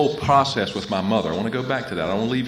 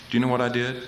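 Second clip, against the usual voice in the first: ordinary delivery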